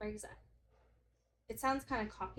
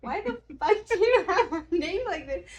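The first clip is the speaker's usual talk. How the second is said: high, loud laughing outrage; 9 semitones higher, 12 dB louder than usual. Retold or imitated. Finding her odd contact name, loud laughing outrage. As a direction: blurt it out laughing. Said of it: "And I'm like".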